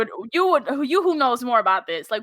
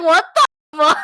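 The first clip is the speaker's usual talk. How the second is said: loud, higher burst of disbelief; mock shock; 5 semitones higher, 7 dB louder than usual. Voicing Nate stunned that Dave speaks Spanish, mock shock. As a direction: play stunned, pitched up and louder.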